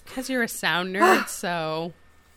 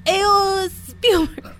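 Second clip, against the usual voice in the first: loud, high, hammed-up French accent; mock French-Canadian shout; terrible French accent; 9 semitones higher, 4 dB louder, much slower than usual. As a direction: call out in a badly faked French accent. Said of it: "That's the worst French accent ever".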